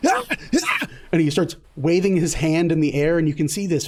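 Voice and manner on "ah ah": high startled yelps as the rat bites; yelp of pain. Acted. Lamont bitten by a rat, high yelps. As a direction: cry out sharply. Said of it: "you hear Lamont go".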